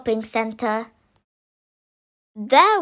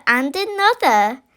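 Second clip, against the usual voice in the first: ordinary delivery, nothing marked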